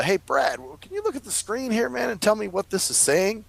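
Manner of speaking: high, light friendly request. Retold or imitated. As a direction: casually ask a favor. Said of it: "And I said".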